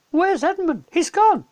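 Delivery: put-on panicked little girl; Lucy. A narrator's reading. Lucy discovering Edmund missing, high and alarmed.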